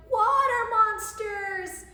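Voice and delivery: high, loud, drawn-out shriek; hammed-up scream of alarm; frightened cry. A narrator's reading. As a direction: cry out in storybook terror. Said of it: "And one cried".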